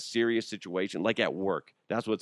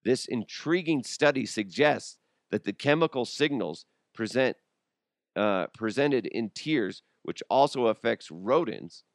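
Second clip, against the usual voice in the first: normal talking voice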